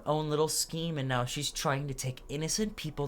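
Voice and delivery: indignant denial. Acted. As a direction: turn the blame back. Rival accusing back.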